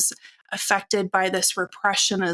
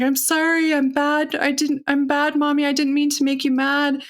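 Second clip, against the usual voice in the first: put-on higher-pitched pleading child voice; crying little girl; 6 semitones higher than usual. Small daughter apologising to her angry mother, imitated tearful pleading. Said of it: "she started crying. She was like".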